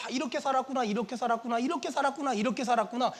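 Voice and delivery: hushed rapid musing; musing realization. Acted. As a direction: murmur it over and over, passing from picture to picture. Acting out viewers looking at photos.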